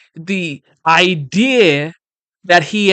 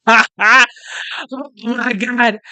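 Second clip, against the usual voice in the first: high laugh running into the words; 4 semitones higher, much slower than usual. Real, not acted. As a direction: laugh in disbelief, then exclaim through it. Laughs after the played speech ends.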